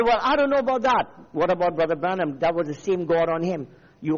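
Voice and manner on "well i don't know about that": higher, louder, quick retort; mock objector. Preacher imitating a listener's objection, mock protesting. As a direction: voice a doubter's protest, pitched up. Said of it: "You say".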